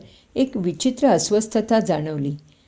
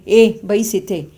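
louder, slow barked command; shouting order; 6 dB louder, slower than usual. Acted. Angry father ordering Ratikant to stay seated, loud slow command. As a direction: stop him with a sharp order. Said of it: "आदरबाबू ओरडले".

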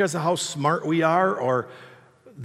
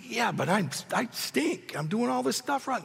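higher, softer self-mocking protest; 6 semitones higher, 6 dB quieter than usual. Preacher replaying his protest to God, mock whining. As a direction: object meekly, pitch climbing.

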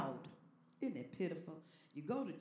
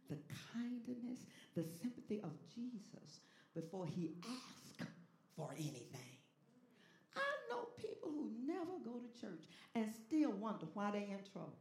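ordinary talk

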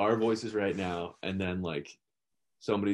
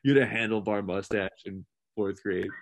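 ordinary talk, nothing marked

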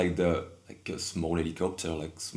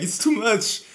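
laughing overwhelmed; 9 dB louder than usual. Retold or imitated. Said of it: "I was just like".

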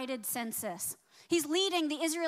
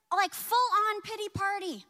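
ordinary delivery

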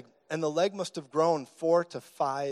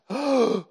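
loud, high crowd gasp; imitated gasp; 9 semitones higher, 6 dB louder than usual. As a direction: mimic a shocked audience's sharp intake. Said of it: "they actually gasped".

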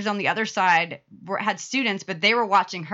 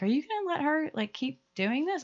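doubting parent; 8 semitones higher, 5 dB quieter than usual. Retold or imitated. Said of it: "a lot of parents were like".

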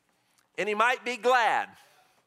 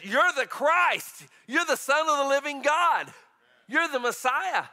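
excited confession; 6 semitones higher, 4 dB louder than usual. Acted. Peter confesses Jesus as Christ.

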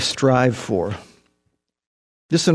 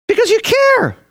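impassioned cry. Real, not acted. Preacher's emphatic peak.

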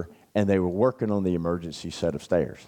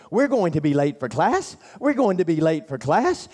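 higher, hammed-up fretting; mock panic; 8 semitones higher than usual. Roommate parodying the narrator's worry, hammed-up panic. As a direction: play a frantic worrier for laughs.

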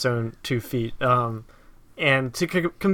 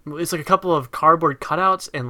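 ordinary delivery

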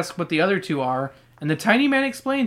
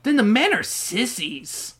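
higher, drawn-out jeer; mocking retort; 4 semitones higher, slower than usual. [name] jeering at the dancing men, mocking. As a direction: stretch it into a childish taunt.

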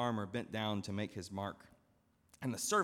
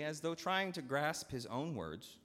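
ordinary talk, nothing marked

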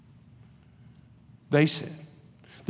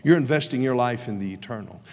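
ordinary talk

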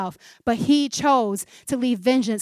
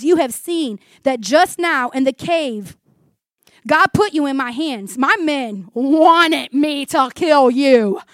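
higher, louder, drawn-out pleading; David's earnest appeal to Saul, read big; impassioned scripture reading; 3 semitones higher, 7 dB louder than usual. Acted. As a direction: proclaim it forcefully, stretching key words. Reading David's speech to Saul dramatically.

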